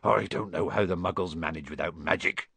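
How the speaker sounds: low, big-man Hagrid voice; Hagrid's voice. A narrator's reading. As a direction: rumble it like a gentle giant. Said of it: "he said".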